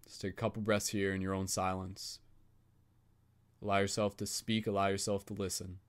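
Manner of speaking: soft, even meditation voice; guided meditation. Acted. Facilitator guiding the group circle in calm meditation voice. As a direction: gently guide the circle inward.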